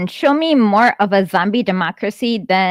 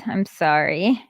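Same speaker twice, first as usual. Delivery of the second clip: ordinary talk, nothing marked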